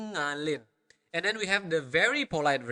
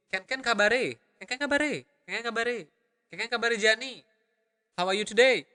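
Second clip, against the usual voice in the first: "Ken ken kabare" repeated quickly and higher, native rhythm; natural speed; 6 semitones higher, faster than usual. Shown as a demonstration. Teacher repeating the greeting at native speed for students, higher and fluid. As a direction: rattle it off like a local. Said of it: "the accent and the natural speed".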